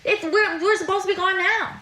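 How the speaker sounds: high-pitched, exasperated call; impatient calling. Real, not acted. Mentor telling a housemate they are late, impatient calling. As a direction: urge the dawdler along from across the house. Answering a dawdler, running late.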